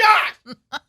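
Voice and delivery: yelling for husband from across the lot; yelling a name. Retold or imitated. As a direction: shout it high and loud across a parking lot. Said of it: "that's exactly how you did it".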